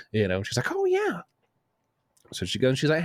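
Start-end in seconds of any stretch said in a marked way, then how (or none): 0.7-1.2 very high girlfriend imitation; sudden remembering. Girlfriend reminded of her french fries, high mimicry. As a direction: mimic an airy moment of recall, way up high.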